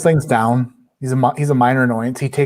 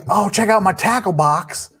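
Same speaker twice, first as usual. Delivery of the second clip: higher, show-off fishing bro voice; mock fisherman boast; 7 semitones higher than usual. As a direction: brag like a dopey angler. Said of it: "it's like".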